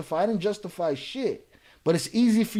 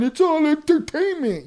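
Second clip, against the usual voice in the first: high, louder mocking sing-song of his excuse; mocking quote; 11 semitones higher, 7 dB louder than usual. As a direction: throw his line back at him in a silly voice. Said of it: "and then you keep saying".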